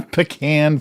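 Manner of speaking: pecan pronounced puh-KAN; Texas pecan. Shown as a demonstration. Reading out a pie comment, pecan said puh-KAN. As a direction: open pecan on puh, not pee. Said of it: "I'm emphasizing the P over the P".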